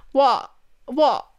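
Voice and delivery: loud, repeated mock-British whats. Annoying Nathan with her favorite word, loud British accent. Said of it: "is my favorite thing to say".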